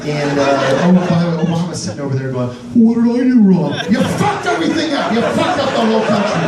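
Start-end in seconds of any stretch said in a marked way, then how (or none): 2.7-3.7 put-on higher, louder, whining like a scolded kid; whiny Obama. Comic whiny Obama asking what he did wrong. 3.9-6.4 mock rant. Comic yelling at Obama.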